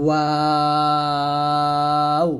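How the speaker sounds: ওয়াও drawn out long through rounded lips, rising; Arabic letter waw. Shown as a demonstration. Teacher showing learners ওয়াও with rounded lips, long. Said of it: "দুই ঠোঁট গোল করবেন".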